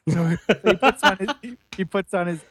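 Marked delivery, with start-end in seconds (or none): none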